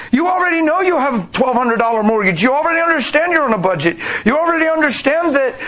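ordinary talk

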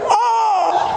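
high-pitched shouted "oh"; exclamation thrown way up; loud high cry. Acted. Acted outburst, high shout.

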